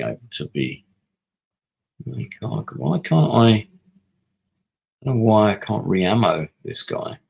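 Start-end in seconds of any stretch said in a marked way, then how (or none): 2.0-7.2 exasperated, louder rising complaint; why can't I, real annoyed cry; frustrated outburst. Real, not acted. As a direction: vent at the stubborn game. Can't re-ammo unit, frustrated.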